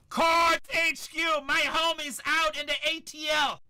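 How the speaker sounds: high, loud hype shout; excited shout-out. Real, not acted. Breaker shouting out his homie in Atlanta, loud and excited. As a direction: yell it out to a friend watching.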